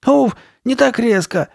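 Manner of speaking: high, loud pained yelp; cry of pain. A narrator's reading. Carly Beth to the friend tugging her mask, crying out. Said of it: "крикнула она".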